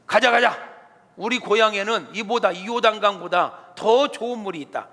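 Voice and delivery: loud, high angry outburst; angry general. Acted. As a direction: storm off in a huff, shouting. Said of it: "그럼 화를 냅니다". (화가 나서 외치는 장군)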